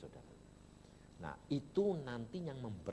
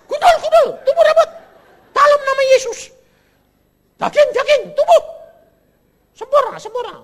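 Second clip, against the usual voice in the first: very loud, high shouted decree; shouted proclamation; 23 dB louder, faster than usual. Retold or imitated. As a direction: shout it out, in Jesus' name. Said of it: "Nggak, keras". (menyuruh anak muda bersorak sangat keras)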